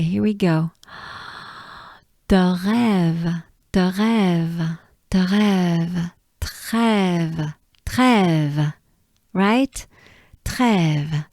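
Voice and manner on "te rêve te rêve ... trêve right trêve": te rêve then trêve, breathy French R. Shown as a demonstration. Teacher drills trêve slowly with students. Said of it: "go slowly with it".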